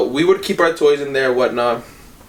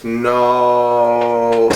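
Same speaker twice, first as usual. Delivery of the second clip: retold despair; 4 semitones higher, much slower than usual. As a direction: wail no as the thumb gets crushed. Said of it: "I'm just like".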